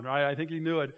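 usual speaking voice

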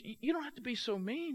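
mildly hurt, high-pitched protest; wounded protest. Retold or imitated. Woman hurt by friend's retort.

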